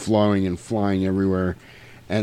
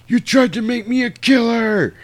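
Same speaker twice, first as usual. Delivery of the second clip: high, strained outcry; mock anguished accusation; 11 semitones higher than usual. Michael accuses David, high anguished cry. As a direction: hurl it out as hammed-up melodrama. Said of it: "And Michael goes".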